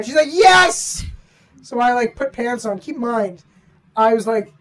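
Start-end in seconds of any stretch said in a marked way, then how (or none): 0.3-1.0 eager yes. Retold or imitated. Friend eagerly answers.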